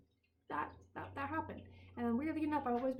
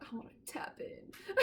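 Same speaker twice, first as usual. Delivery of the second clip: whispered with a giggle; laughing whisper; 8 semitones higher than usual. Retold or imitated. Wanting to connect with ghosts, giggly whisper. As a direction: breathe it, half laughing. Quoting own wish, laughing.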